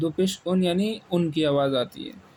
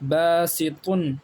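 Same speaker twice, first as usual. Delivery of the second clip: باسطن stretched very slowly as ba-si-tun; slow model reading; much slower than usual. Shown as a demonstration. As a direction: drag out باسطن for the learner. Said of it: "اسی طریقے سے".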